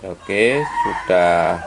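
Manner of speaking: ordinary talk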